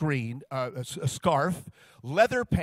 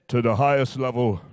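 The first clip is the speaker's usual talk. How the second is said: lower, louder big-man voice; 6 semitones lower, 5 dB louder than usual. Retold or imitated. [name] answering [name]'s follow-up.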